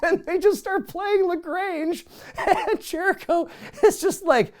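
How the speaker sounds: high-pitched speech breaking into laughter; laughing. Real, not acted. Host retelling Jericho's bewilderment at the band, laughing. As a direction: barely get it out for laughing.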